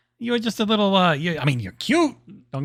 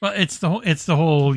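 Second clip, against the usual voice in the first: ordinary talk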